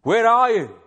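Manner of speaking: loud anguished cry to God; cried out. Acted. Jesus on the cross crying out to God, anguished loud cry. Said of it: "he cried out".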